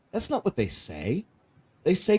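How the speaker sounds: normal talking voice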